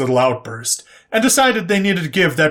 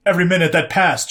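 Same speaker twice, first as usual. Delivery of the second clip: ordinary delivery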